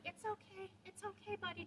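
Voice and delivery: put-on high, soft baby-the-dog voice; coddling reassurance. Owner comforting the fearful dog, coddling. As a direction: soothe a nervous dog, softly and high.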